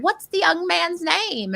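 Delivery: put-on high, drawn-out old lady voice; seventy-year-old shop lady. Shop lady asking about a birthday button, high slow old-lady voice. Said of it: "like, seventy years old".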